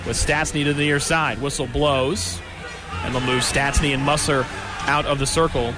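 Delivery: ordinary delivery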